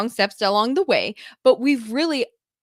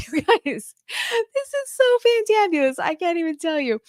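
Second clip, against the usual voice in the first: excited gush; 9 semitones higher than usual. Real, not acted. Gushing about son's discussion.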